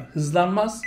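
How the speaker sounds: ordinary talk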